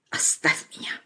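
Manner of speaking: whispered, breathless demand; harsh whisper. A narrator's reading. Anya pushes Vasya away, whispered. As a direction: hiss it at him.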